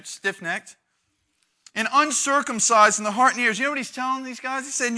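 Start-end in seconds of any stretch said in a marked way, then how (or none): 1.7-3.6 accusing. Acted. Quoting Stephen.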